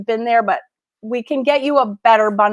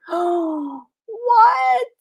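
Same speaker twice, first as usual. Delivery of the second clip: gasp, then a high, drawn-out what; high-pitched disbelief after a sharp gasp; real astonishment; 7 semitones higher than usual. Real, not acted. Book lover opening a dragon book, astonished.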